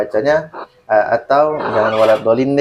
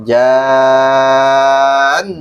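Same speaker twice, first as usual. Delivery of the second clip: JAN drawn out long and loud with a held nasal hum; intonazione tenuta; 5 dB louder than usual. Shown as a demonstration. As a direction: sustain JAN in a strong recitation tone. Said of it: "tidak perlu dinaikkan intonasinya".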